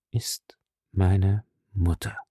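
slow, low, hollow voice; hollow and weak. A narrator's reading. Young woman asks after her mother, hollow and weak. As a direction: ask weakly, words falling away. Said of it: "Ihre Stimme klang hohl und schwach".

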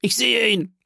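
high-pitched excited call; alarmed shout. A narrator's reading. Pursuer at the keyhole sees the fugitive, calling out. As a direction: call out the sighting. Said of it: "rief eine Stimme auf dem Vorplatz".

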